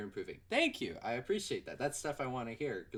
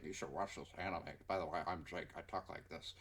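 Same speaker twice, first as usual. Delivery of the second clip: lower, softer dopey friend voice; mocking Jake; 8 semitones lower, 7 dB quieter than usual. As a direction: parody your friend lower and duller. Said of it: "Jake was just like".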